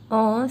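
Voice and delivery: On said as a nasal French on, no n sound. Shown as a demonstration. Teacher isolating the French nasal sound on. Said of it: "gives the sound of on".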